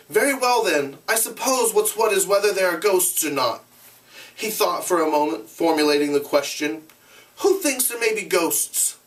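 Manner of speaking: lightly voiced hesitant boy; a hint of a boy weighing his words; boy's voice. Boy leader asks the assembly.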